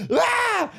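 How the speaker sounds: high sharp yelp of pain; mock cry as the shoulder pops; retold pain cry. Guitar teacher reliving the shoulder popping, sharp high yelp. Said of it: "my whole arm just went like".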